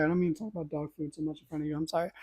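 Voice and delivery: murmured apology to the dog; hushed, quick words to the dog; talking softly to the dog Wendy; soft aside to dog. Real, not acted.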